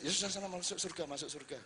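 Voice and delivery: soft, fast, offhand brush-off; dismissive mutter. Pretend. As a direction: wave him off quietly, off to heaven then. God's dismissive reply imagined. (menggerutu pelan dan cepat, acuh)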